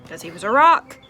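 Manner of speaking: loud, pressing storyteller beat; loud emphatic narration; dramatic emphasis. A narrator's reading. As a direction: land the sad twist with force.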